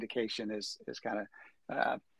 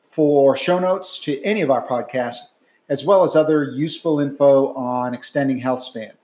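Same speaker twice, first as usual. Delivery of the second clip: loud, polished outro announcement; announcer read; 3 semitones higher, 9 dB louder than usual. Acted. Recorded podcast outro read.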